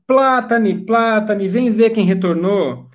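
high, urgent summons; calling out. Acted. Innkeeper summons Plátane to see who returned, calling out. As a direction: call a colleague over to come and look. Innkeeper calls her colleague over.

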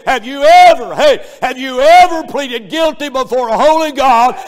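rising, loud preacher's cadence; preaching at full force. Acted. Preacher pleading with the congregation, loud and driving. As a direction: press the sinners hard from the pulpit.

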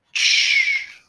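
ch as a sharp voiceless hiss; ch sound. Shown as a demonstration. Teacher showing the ch sound, ch as a hissed burst. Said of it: "words that end with ch".

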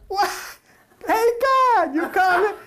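a hammed-up woman calling to God; high Sarah voice. Sarah calls out to God, in a high hammed-up voice.

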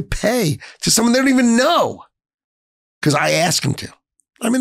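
high-pitched, overcome with feeling; emotional. Real, not acted. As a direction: let the pitch climb as real emotion takes over. Moved by employees' sacrifice for families.

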